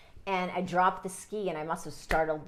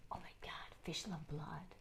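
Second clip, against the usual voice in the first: whispered, much softer; inner panicked thought; 17 dB quieter than usual. Retold or imitated. Boy in bloody water thinking of fish, whispered panic. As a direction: breathe out the fear in a whisper. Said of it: "all I could hear was".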